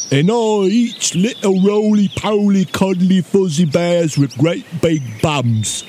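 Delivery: put-on giant voice, threatening; menacing storybook ogre. A narrator's reading. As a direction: threaten a small bear as a hungry ogre.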